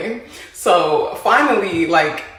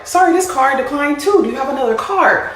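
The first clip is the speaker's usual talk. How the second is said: server voice; 7 semitones higher, 3 dB louder than usual. Retold or imitated. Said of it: "Gives her another card".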